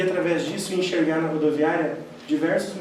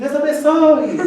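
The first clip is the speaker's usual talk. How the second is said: couple's curt reply; 9 semitones higher, 7 dB louder, slower than usual. Retold or imitated. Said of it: "eles falaram assim".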